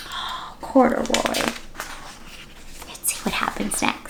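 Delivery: hushed gasping whisper. Acted. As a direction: breathe it out in suspense. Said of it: "hushed suspense".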